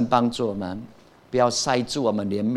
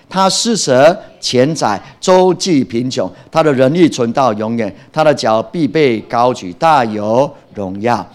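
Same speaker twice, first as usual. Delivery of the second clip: scripture read-aloud; 7 dB louder than usual. Acted. Preacher reading the next verse to the congregation, loud scripture recitation.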